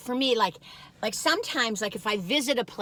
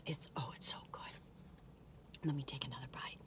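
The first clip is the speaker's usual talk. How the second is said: whispering; 9 semitones lower, 21 dB quieter, faster than usual. Real, not acted. Savouring toast, mouth full.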